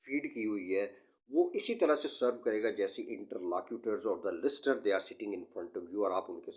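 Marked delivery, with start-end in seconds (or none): none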